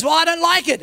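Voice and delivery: retold angry comeback; heated objection. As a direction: object indignantly mid-quarrel. Commander objects in retold quarrel.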